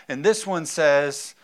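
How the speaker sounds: ordinary talk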